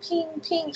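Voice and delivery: high, soft sung ping ping; mock phone ping. Imitating her phone pinging at two in the morning. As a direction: chime it like a phone alert.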